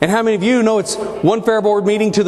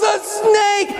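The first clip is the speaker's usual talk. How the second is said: high, frantic shout; panicked yell; 12 semitones higher than usual. Retold or imitated. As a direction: blurt it out in terror. Panicked manager in retold story.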